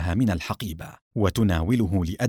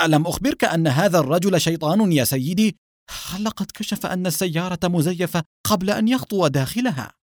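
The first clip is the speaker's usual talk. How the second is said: agitated, high-pitched nervous pleading; frightened underling; trembling young man; 7 semitones higher than usual. A narrator's reading. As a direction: protest in fear, voice pitched up. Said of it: "وهو يرتعد".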